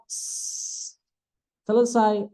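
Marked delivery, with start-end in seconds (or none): 0.1-1.0 hissed "s" with no vowel, lips spread; bare s. Shown as a demonstration. Teacher isolating the sin sound. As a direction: hold a voiceless s. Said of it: "a-nya buang".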